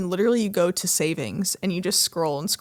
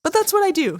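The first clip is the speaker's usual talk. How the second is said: defensive; 11 semitones higher, 5 dB louder than usual. Real, not acted. Defensive retort.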